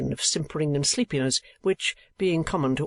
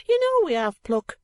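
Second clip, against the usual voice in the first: high, louder protest; reproachful; 4 dB louder than usual. A narrator's reading. Second gentleman answering his partner, reproachful. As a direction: chide your partner in a high voice. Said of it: "said Pike reproachfully".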